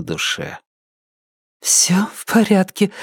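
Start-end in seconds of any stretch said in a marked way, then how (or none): 1.6-2.9 high, light woman's voice; lifted voice, putting on that all is fine; woman reassuring partner. A narrator's reading. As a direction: answer in a raised, hesitant woman's voice. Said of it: "ответила она".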